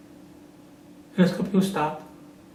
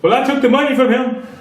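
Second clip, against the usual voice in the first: high, louder mock boast; bragging thief; 11 semitones higher, 4 dB louder than usual. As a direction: show off the loot, hammed-up. Imagined thief brags.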